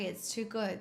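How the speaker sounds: ordinary talk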